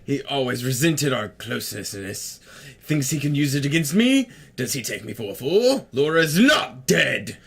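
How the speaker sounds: throat-straining character voice. Acted. Player voicing a character refusing a loved one's death, melodramatic. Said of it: "doing that fucking voice hurts".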